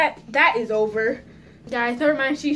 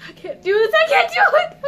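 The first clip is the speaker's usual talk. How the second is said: high, loud, laughing wail; real giggly panic climbing to a shriek; panicking; 9 dB louder than usual. Real, not acted. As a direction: squeal it, half laughing, half crying. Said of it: "I'm so scared".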